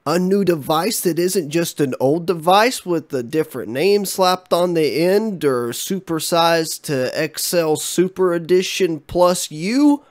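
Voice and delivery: slow, exaggerated disbelief; mock disbelief. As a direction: ham up being shocked. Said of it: "All cheekiness aside".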